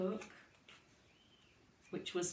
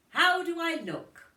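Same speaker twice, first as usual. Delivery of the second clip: hopeful showing off; 11 semitones higher, 9 dB louder than usual. Acted. Mother showing off dress.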